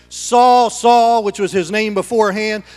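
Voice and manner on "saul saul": loud, raised call from on high; acted calling out. Preacher voicing the Lord calling to Saul, loud. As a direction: call the name out firmly, twice.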